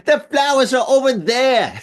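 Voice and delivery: high-pitched, hammed-up Aussie accent; horrible Australian accent. Host mimics tourists pointing out the flowers, horrible Australian accent. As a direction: badly imitate an Australian tourist. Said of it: "That's my horrible, horrible Australian accent".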